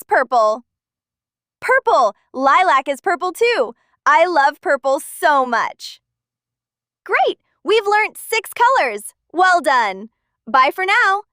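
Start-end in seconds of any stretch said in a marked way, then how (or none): none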